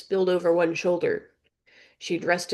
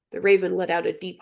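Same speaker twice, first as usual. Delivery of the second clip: plain reading voice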